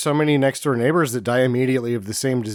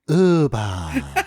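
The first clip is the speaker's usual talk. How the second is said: drawn-out, falling "ooo-bah" noise; imitated pleasant sound. Joking about a sound pleasant to children, imitated noise. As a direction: stretch it out like a silly sound effect. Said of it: "The most pleasant sound to a child".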